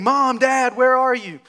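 acted-out yell for Mom and Dad; mock scream. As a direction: call out loudly like a kid searching for parents. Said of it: "running through your house screaming".

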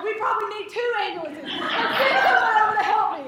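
high, fast, comic panic; frantic retelling, voice climbing; losing it. Retold or imitated. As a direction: build to a loud plea for help. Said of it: "I'm just, like, really losing it".

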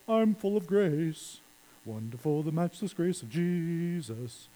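slow, soft, mock-pious sighing. Mocking a smug believer, slow and soft. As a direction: lightly send up a smug churchgoer. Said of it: "walk around going".

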